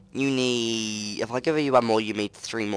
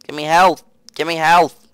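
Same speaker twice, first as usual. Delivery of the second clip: urgent plea; 5 semitones higher, 9 dB louder than usual. Real, not acted. Desperate for healing.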